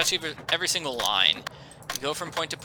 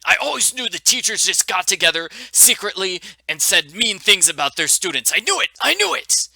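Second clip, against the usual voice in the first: higher, louder mock outrage; triumphant indignation; 6 semitones higher, 4 dB louder than usual. Player vindicated about teachers gossiping secretly, mock-outraged triumph. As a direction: cry vindication, climbing to a high peak.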